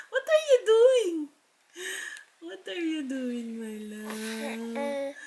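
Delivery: high, soft cooing question. Real, not acted. Mother cooing to baby. (malambing na tanong sa baby)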